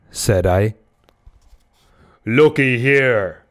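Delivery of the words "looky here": slow, drawn-out harpooner's voice; Queequeg. A narrator's reading. Harpooner shaking off the man's hand, slow and annoyed. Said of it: "said Queequeg, shaking himself".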